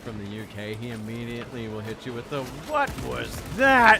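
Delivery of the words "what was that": loud, high, drawn-out challenge; mock indignant outburst. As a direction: imitate a friend pouncing, slow and loud. Friend challenging any attempted UK accent.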